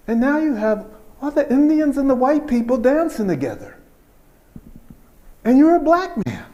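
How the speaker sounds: high, loud astonished question; amazed father. Retold or imitated. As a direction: marvel out loud at it all. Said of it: "he said".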